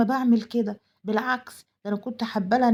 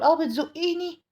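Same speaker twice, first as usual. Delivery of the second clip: higher, indignant gasp of surprise; wife feigning outrage at being shoved; mock outrage; 6 semitones higher than usual. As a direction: act shocked at being pushed. Wife acting shocked at a push.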